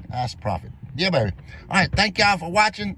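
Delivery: normal talking voice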